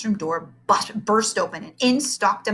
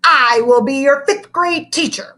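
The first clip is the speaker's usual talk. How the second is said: 1 menacing teacher; 7 semitones higher, 8 dB louder than usual. A narrator's reading.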